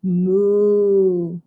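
sustained low cow moo; really low moo. Shown as a demonstration. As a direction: hold a deep, even moo. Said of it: "moo really low".

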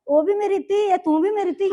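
exasperated mother insisting; insistent pleading. Acted. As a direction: press the point firmly. Mother insists both are daughters.

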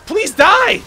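high, loud, strained shouts; desperate urging. Real, not acted. Player begging an enemy to die, desperate shouting. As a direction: goad the enemy through mounting frustration.

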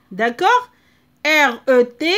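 ordinary talk

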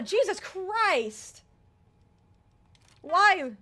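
loud high startled outburst; startled yell. Real, not acted. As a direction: jump at the sudden surprise. Startled by character in path.

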